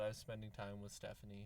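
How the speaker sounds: low, soft sheepish admission; an owner quietly confessing to the dog. Retold or imitated. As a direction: mumble the excuse guiltily. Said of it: "It's like".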